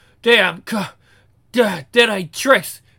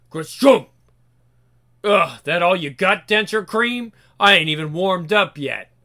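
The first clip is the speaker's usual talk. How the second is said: normal talking voice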